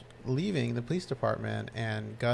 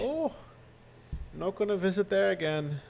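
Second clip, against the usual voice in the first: casual; 8 semitones higher than usual. Retold or imitated. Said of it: "she's saying this, like, no big deal".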